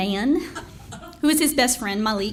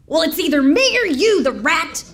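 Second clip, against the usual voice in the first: a loud, higher mock yell at the rat; hammed-up yell, louder and higher at the end; mom yelling at rat; 6 semitones higher, 5 dB louder than usual. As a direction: bellow it like a fed-up mom in a fight. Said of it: "her yelling".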